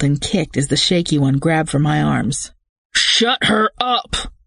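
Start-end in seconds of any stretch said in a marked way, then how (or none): 2.9-4.3 slow, high, hard command; ordered. A narrator's reading. Leader ordering the back seat from the front. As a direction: bite out each word. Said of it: "the leader ordered".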